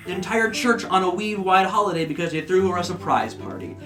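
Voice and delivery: ordinary talk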